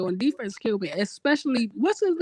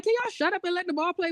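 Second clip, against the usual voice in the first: exasperated; 7 semitones higher, faster than usual. Retold or imitated. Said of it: "And I'm like".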